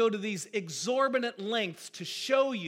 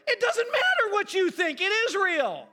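laughing outburst; 12 semitones higher, 6 dB louder than usual. Real, not acted. Preacher answers the mock quote.

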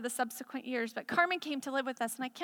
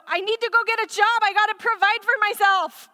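mock anxious self; 7 semitones higher, 8 dB louder than usual. Voicing own anxious self.

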